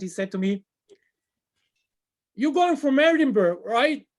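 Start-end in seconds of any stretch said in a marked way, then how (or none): 2.3-4.1 light imitation of a strong Scottish accent, high and loud; mock Scottish accent. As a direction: retell the controller in a hammed-up Scottish accent. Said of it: "with a very hard Scottish accent".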